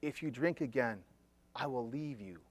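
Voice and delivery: low, soft and deadly serious; quiet ultimatum. Retold or imitated. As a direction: say it softly, as a final warning. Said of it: "She goes".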